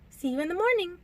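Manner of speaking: high, lilting goodnight; breezy high-voiced farewell; amused. A narrator's reading. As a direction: sing it out lightly, pitched high. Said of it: "Alice laughed".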